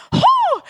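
loud, high-pitched "huh"; sharp high cry of amazement; awestruck gasp. Retold or imitated. Retelling the moment she saw Jesus, awestruck cry.

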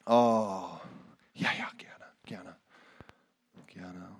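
whispered prayer. Real, not acted. Quiet prayer response.

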